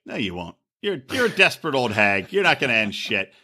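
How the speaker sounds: low, gruff mock quote; deep mocking voice. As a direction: growl it out low. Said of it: "he's like".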